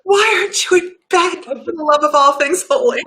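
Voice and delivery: high, loud playful exasperation; mock scolding. Real, not acted. Host to friend awake in the early morning, mock scolding. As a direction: scold a friend in fond disbelief.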